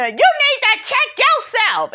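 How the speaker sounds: high-pitched mock yell at Irena; retold yell. Sherelle yelling at Irena, high mock yell. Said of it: "Sherelle yells at Irena".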